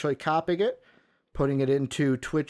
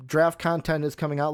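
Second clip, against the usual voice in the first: normal talking voice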